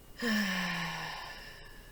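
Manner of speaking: long, drawn-out sigh. Acted. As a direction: sigh slowly over a fond morbid memory. Savouring memory of maggots.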